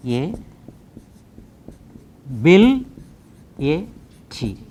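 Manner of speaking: "A, bill, A, T" as four separate syllables; ability broken up with long gaps between syllables. Shown as a demonstration. Teacher showing four syllables, "A, bill, A, T" said piece by piece. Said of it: "Four syllables".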